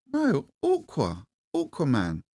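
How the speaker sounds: high, slow insistent "No, Aqua" in a lightly voiced boy's tone; imitating son. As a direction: mimic the son correcting, drawn out. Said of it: "I went".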